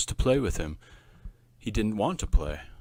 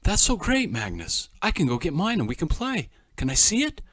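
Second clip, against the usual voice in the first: excited; 8 semitones higher, 6 dB louder than usual. A narrator's reading. Eager boy seeing car.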